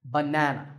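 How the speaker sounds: banana with NAN stressed; stress on second syllable. Shown as a demonstration. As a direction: lean on the middle syllable of banana. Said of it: "The second".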